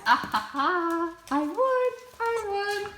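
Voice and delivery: loud, high gloating laugh; triumphant laugh. Real, not acted. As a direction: crow in victory, laughing. She got her seat back.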